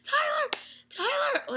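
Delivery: high, desperate calling out of a name; yelling at the TV. Retold or imitated. Fan yelling at the TV for a character, high calling. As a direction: call out the name, high and pleading. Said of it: "yelling at my freaking T V".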